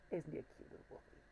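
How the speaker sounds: quick, hushed whisper; whispered aside. Acted. Whispering the same words to the plush wolf. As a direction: whisper it fast like a secret. Repeats the line to the toy.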